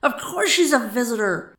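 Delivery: big exasperated bellow; roared. A narrator's reading. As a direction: roar down the doubters. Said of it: "roared Buck".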